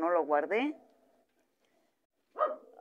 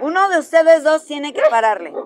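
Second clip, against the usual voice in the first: loud, raised voice laying down the law; stern warning; 6 semitones higher, 10 dB louder than usual. Real, not acted. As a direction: order two squabbling dogs to stop. Ordering two dogs to stop.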